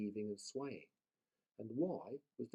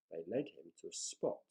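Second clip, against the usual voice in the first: plain reading voice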